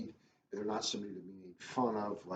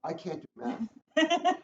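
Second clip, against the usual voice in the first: mock despair; 6 dB louder, much slower than usual. Real, not acted. Comic confession, whole room laughs.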